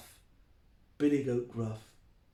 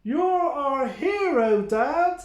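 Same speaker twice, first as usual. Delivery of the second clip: high, loud praise; excited goat; 9 semitones higher, 8 dB louder, slower than usual. Acted. Mike praises his dad, high and loud. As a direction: cheer your dad, high and proud.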